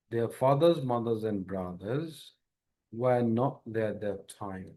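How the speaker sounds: mothers and brothers said very slowly; slow reading demo. Shown as a demonstration. Teacher reading a sentence slowly for learners. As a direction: read each word slowly and clearly. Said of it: "একটু দেখাই".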